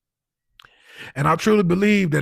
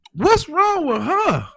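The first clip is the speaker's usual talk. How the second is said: slow, higher, alarmed question; 10 semitones higher, much slower than usual. Retold or imitated. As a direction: drag it out, scared and confused. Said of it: "I'm like".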